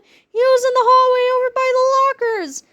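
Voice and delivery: high, breathless fright; scared kid. A narrator's reading. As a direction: tell it like you're still scared. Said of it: "cried Harold".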